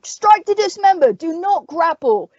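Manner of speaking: high, loud battle shout; shouted commands. A narrator's reading. Captain to his men charging the dead, shouting. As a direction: yell the orders across the field. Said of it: "he heard the owl shouting".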